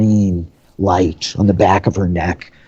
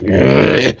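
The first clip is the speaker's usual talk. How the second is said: short, forceful groan of pain; pained grunt; 4 dB louder than usual. Acted. Minotaur branded on the neck, pained grunt. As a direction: grunt as if a sharp pain hits. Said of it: "they cry out in pain".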